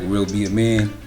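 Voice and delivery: usual speaking voice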